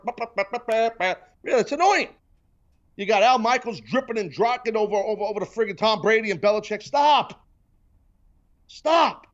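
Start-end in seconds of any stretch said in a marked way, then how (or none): 0.1-1.2 short blurted trumpet imitation; mock trumpet noise. Host mocking the Patriot trumpet, imitated bah-bah toots. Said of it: "playing the Patriot trumpet". 6.8-9.3 loud, high, exasperated cry; exasperated outburst. Real, not acted. Host fed up with pregame hype, loud exasperated outburst.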